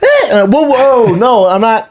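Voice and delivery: high, stammering mock panic; panicked backpedaling. Boyfriend calming her in public, panicked backpedaling. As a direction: take it all back in a high voice.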